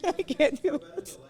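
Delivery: high tearful laugh into the words; real tears caught mid-laugh; laughing while crying. Real, not acted. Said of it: "Why are you making me cry".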